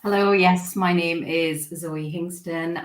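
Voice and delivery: usual speaking voice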